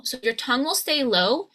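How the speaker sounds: ordinary talk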